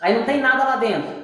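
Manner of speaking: ordinary delivery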